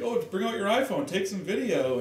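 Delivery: high, breezy invitation; friendly sonographer. Retold or imitated. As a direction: invite her warmly to film. Friendly sonographer at private clinic.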